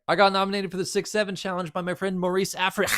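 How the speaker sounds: hyped intro voice. Pretend.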